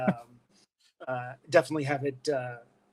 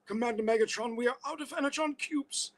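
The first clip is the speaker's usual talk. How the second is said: logical, dispassionate robot voice, pitched up; Shockwave; 9 semitones higher than usual. Acted. Actor voicing Shockwave reporting to Megatron, dispassionate and factual. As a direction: report it as pure fact. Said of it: "Shockwave is like".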